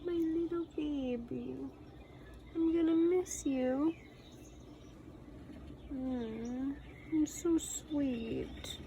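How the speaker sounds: soft high cooing, slow. Real, not acted. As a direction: gently coo at a tiny pet. Cooing at the little pet.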